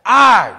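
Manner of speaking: loud, high, strained "I" sound; imitating a brain-injured woman's loud effortful "I"; imitated vocal sound. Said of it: "Terry Schiavo would come back".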